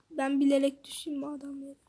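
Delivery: fast conspiratorial whisper; whispered aside. Real, not acted. Gamer joking he'd fall on purpose, fast whisper. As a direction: hiss it quickly as a sly joke.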